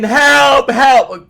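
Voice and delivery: loud, high mock scream; screaming for help. Stranger panicking at a hello, hammed-up scream. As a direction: yell out as if attacked. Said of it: "and just start screaming".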